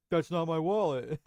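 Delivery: dopey cartoon starfish voice, laughing; goofy clueless cartoon voice; Patrick. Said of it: "and Patrick is like".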